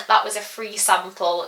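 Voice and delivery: ordinary delivery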